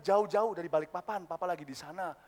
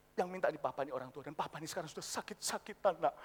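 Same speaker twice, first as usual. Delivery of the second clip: low, soft, guilt-laden plea; frail old father; 8 semitones lower, 6 dB quieter, faster than usual. Acted. Sick old father reminding his child who asks, low and soft. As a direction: plead quietly as an ailing parent.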